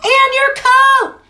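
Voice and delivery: high, loud and drawn out; mother adding. Acted. Mother adds a reminder, high and drawn out. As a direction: tack it on, a bit exasperated. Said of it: "added his mother".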